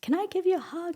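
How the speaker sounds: imitating a shy request, pitched high; high, tentative mock-timid voice. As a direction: play someone shyly asking permission. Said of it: "was really timid at first".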